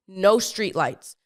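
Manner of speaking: normal talking voice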